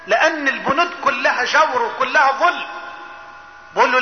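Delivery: ordinary talk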